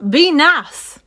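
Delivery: sharp, loud, high scold. Acted. Mama duck scolds the ducklings, loud. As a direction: snap the kids into line. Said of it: "she would scold".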